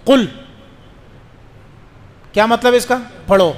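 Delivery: ordinary talk, nothing marked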